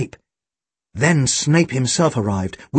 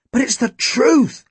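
high-pitched insistent protest; higher, urgent boyish insistence; 10 semitones higher than usual. A narrator's reading. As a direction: insist on being believed, pitch well up. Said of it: "Tim insisted".

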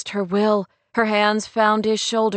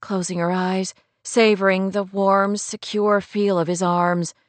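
ordinary delivery, nothing marked

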